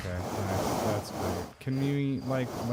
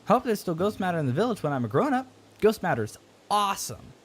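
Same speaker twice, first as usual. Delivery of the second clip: a hint of a pleading child, higher; lightly voiced kid; 7 semitones higher than usual. A child begging the astronaut for the signal scope, higher and eager. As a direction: read the alien kid's lines, a little higher and eager.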